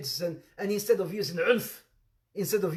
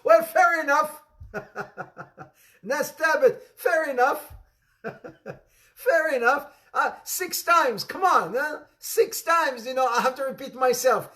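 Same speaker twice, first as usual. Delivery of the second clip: laughing; 6 semitones higher, 5 dB louder than usual. Real, not acted. Laughs at student's answer.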